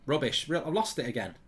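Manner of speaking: ordinary talk, nothing marked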